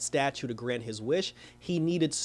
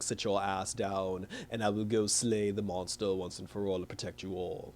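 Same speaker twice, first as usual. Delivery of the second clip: low, soft, mock-heroic vow; lightly acted hero; 6 semitones lower, 8 dB quieter than usual. Hero tells girlfriend to stay behind, in a low mock-hero voice. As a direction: imitate a solemn hero, pitched down and quiet. Acting Thor ordering Jane to stay.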